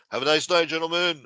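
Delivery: imitating a dismissive doorman; security guard. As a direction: turn them away like a club bouncer. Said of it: "Big-ass security guards used to say".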